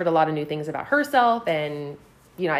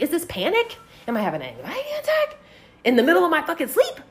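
panicked questioning; 8 semitones higher than usual. Retold or imitated. Retold panicked inner thought.

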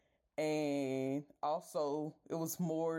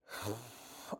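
tired sigh; 6 semitones lower, 6 dB quieter than usual. Real, not acted. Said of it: "I'm just getting upset".

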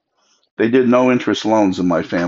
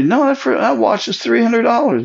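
indignant protest; 14 semitones higher than usual. Retold or imitated. Borrower protests watch's worth.

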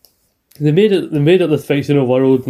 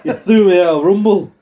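higher-pitched mock-aggrieved wrestler; British Bulldog; 5 semitones higher than usual. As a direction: call out in a hammed-up wrestler impression. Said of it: "to make the British Bulldog".

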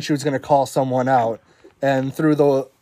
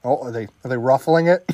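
normal talking voice

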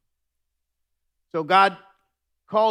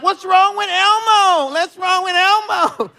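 put-on high, loud, slow childlike whine; worried kids; 11 dB louder than usual. Party kids asking about staggering Elmo, high and slow. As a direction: mimic puzzled children.

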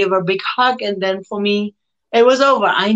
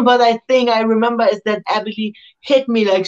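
normal talking voice

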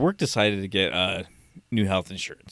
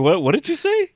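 startled question; 13 semitones higher, 6 dB louder than usual. Retold or imitated. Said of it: "and I was like".

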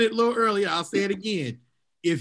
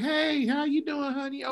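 cool polite mom; 8 semitones higher, 4 dB quieter than usual. Retold or imitated. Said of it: "She's just gonna be like".

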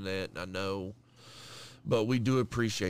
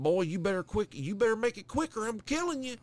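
put-on country accent; 10 semitones higher than usual. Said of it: "he was country as fuck".

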